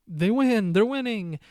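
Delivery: high, loud burst of excitement; excited cheer. Retold or imitated. Fan in the theater cheering a win, loud and high. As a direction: cheer like the heroes just won. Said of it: "where we're like".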